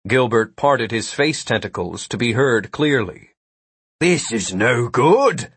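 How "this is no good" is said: slow monster voice. A narrator's reading. Gilbert speaks in dialogue.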